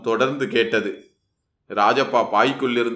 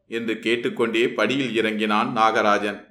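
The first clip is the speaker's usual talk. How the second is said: ordinary delivery, nothing marked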